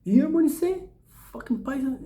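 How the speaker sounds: high, teasing imitation of the insult; mocking repeat. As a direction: egg him on by mimicking the insult. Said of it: "and I say".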